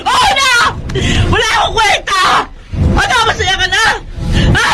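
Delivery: wife bitterly agreeing to his insult, shouting; bitter shout back. Acted.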